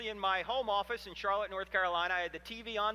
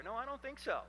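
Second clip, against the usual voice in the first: usual speaking voice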